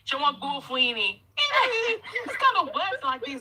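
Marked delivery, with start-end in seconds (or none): 1.4-2.2 high laugh. Real, not acted. Friend teasing him over "opportunity", high cackling laugh.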